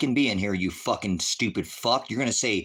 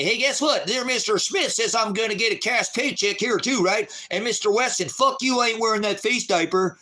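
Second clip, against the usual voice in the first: high sneering mock voice of a tough-talking gun guy; mocking gun owner; 11 semitones higher than usual. Streamer mocks viewer's fake workplace bravado, high sneering impression. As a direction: ham up a blustering coworker, pitched way up. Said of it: "You didn't go into work and say".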